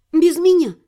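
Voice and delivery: put-on high, firm boyish refusal; cutting refusal. A narrator's reading. Servant boy refusing to help steal a key, curtly cutting it off. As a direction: cut the idea dead in a lad's voice. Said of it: "отрезал парень".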